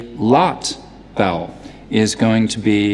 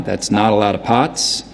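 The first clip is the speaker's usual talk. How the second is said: New York "ah" in "not", "lot" and "pots"; unrounded New York vowel on "lot" and "pots"; New York accent. Shown as a demonstration. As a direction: open "ah" vowels, like a New Yorker. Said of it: "If they say it with an ah".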